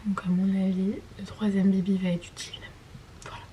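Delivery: hushed, unvoiced whisper. Real, not acted. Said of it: "je l'entends".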